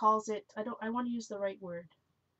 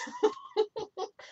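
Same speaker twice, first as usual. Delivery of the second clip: high laugh; 11 semitones higher than usual. Real, not acted. Laughing at her own mistake.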